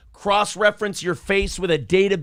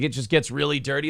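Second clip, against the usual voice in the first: usual speaking voice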